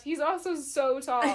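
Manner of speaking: loud, slow, gushing exclamation; all caps gushing. Retold or imitated. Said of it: "I also wrote in all caps".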